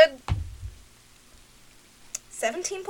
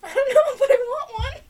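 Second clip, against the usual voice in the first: excited; 9 semitones higher, 6 dB louder than usual. Real, not acted. Wants a kakapo pet.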